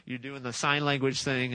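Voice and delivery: usual speaking voice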